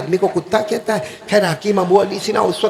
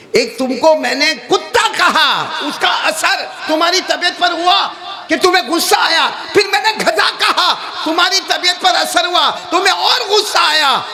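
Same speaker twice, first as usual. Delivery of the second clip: emphatic preaching; 10 semitones higher than usual. Acted. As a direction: drive the lesson home at full force. مولانا دلیل پر زور دیتا ہے. (زوردار خطابی انداز)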